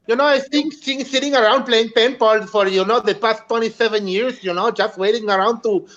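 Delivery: Mexican accent with a lisp on "things sitting around". Shown as a demonstration. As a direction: lisp the s sounds in "twenty-seven years". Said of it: "if I put a lisp in it".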